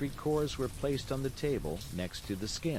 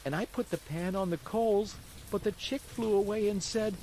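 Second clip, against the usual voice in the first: put-on high puppet boy voice, pitched well up; a hungry wooden boy telling his woes; high, childlike marionette recounting his night; Pinocchio's voice; 11 semitones higher than usual. Pinocchio tells his story.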